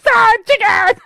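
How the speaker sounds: high, loud shrieked yell; full yell. Retold or imitated. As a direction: bellow it across the bank. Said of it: "yelled as loud as I could".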